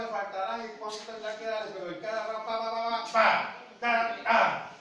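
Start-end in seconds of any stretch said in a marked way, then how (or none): 2.5-4.7 imitated punches. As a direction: sound out a volley of boxing hits. Imitates boxing blows.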